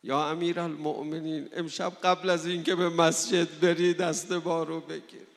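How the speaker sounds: loud, crying-out supplication to Imam Ali; impassioned plea. Acted. Preacher begging Imam Ali for help, loud impassioned plea. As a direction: call out to Ali for help, full force.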